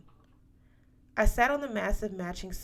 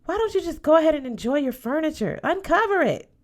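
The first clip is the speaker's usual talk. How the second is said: high, loud, fast outburst at the aunt; loud, pitched-up plea to uncover the furniture; exasperated yell; 9 semitones higher, 8 dB louder than usual. A narrator's reading. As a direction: blurt it out like you can't hold it in any longer. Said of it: "I wanted to yell".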